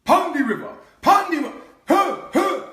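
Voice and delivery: high, shouted Jamaican patois; booming Jamaican call; Jamaican accent. Retold or imitated. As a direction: holler in Jamaican patois from the gut. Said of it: "how Jamaicans speak".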